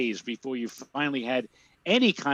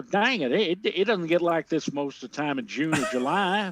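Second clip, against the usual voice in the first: put-on light Southern accent, folksy; a hint of small-town Southern twang; Southern drawl; 2 semitones higher than usual. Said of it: "talks like they've been on the Andy Griffith Show".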